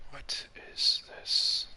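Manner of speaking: slow breathy whisper; puzzled whisper. Real, not acted. Looking at odd cargo, a slow puzzled whisper. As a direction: murmur it, baffled.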